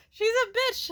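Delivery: high, laughing through it; cracking up. Real, not acted.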